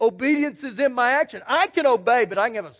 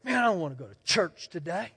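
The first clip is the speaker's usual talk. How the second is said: grumbling churchgoer; 6 semitones lower, 8 dB quieter, faster than usual. Pretend. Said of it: "you'd stomp your foot and say".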